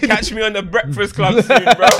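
high, loud teasing brag; mock boast. Mocking the rapper touring clubs, hammed-up brag. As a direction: play the retired rapper doing club appearances.

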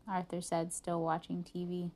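plain reading voice

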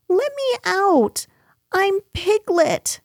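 very high, protesting little voice; distressed Piglet. A narrator's reading. Piglet trapped in Kanga's bath, crying out. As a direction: plead and protest, pitched right up. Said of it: "cried Piglet".

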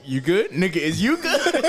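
loud, slow, laughing "you good"; laughing mock concern. Teasing friend with laughing loud concern. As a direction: crack up while asking. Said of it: "I was like".

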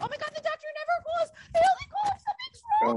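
high, fast, rising panic; frantic high panic; panicked squeal. Retold or imitated. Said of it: "I was like".

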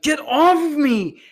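loud, high, drawn-out cry imitating the man; mock panicked yell. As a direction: imitate him yelling to be let go. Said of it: "this guy was very much like".

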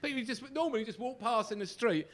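ordinary delivery

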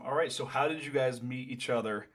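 ordinary talk

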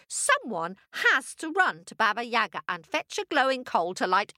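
high, scolding stepmother voice; wicked stepmother. Acted. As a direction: scold her like a cruel stepmother. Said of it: "shrieked the stepmother".

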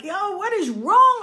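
high, drawn-out baffled call. Retold or imitated. Kids following behind mother, calling out in confusion. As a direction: call after her, stretching the words. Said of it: "We calling her like".

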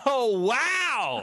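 very high, hammed-up American awe; American amazement. American learning where the tourist is from, hammed-up amazement. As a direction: gush like an astonished American. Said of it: "And I'll be America".

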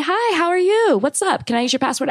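high, friendly mock greeting; super friendly. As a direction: play an over-friendly neighbor at the door. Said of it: "been super friendly".

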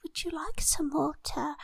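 timid whispered offer. Retold or imitated. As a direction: breathe the offer, barely voiced.

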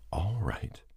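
soft whispered "All right." to calm the dog; hushed, reassuring whisper of "All right."; soothing whisper. A narrator's reading. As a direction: breathe "All right." gently to the frightened dog. Calming a terrified dog.

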